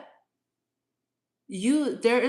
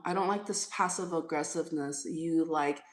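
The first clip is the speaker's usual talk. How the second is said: ordinary talk, nothing marked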